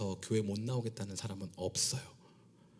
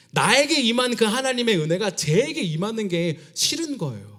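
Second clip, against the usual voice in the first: builds higher and louder to the peak; rising fervent preaching; 8 semitones higher, 9 dB louder than usual. Acted. Pastor warning the congregation about envy, building loud. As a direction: press the point harder line by line.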